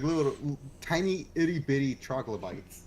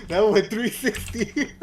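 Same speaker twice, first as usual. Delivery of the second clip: laughing; 9 semitones higher, 9 dB louder, slower than usual. Real, not acted. Laughing at friend's joke.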